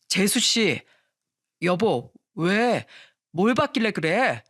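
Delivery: mildly alarmed questions; startled calling out. A narrator's reading. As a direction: call out with a touch of surprise. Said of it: "보고 놀라 소리쳤습니다". (약간 놀라서 부르는 목소리)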